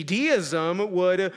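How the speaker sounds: ordinary talk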